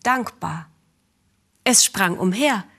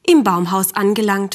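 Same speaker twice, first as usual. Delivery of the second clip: usual speaking voice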